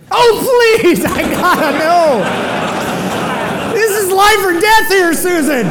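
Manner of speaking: high, loud, hammed-up begging; frantic pleading. Husband begging wife after technician's hint, frantic pleading. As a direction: wail it as if life depends on it.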